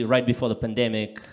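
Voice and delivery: usual speaking voice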